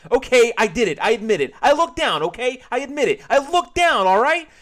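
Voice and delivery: very high, loud frantic admission; frantic confession. Acted. George confessing to the cashier, frantic loud outburst. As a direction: crack under pressure and blurt it all.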